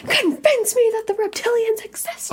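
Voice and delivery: high, challenging skeptic voice; skeptic challenger. Retold or imitated. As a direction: dare them to prove it. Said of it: "the guy on the lawn with the chair and the desk".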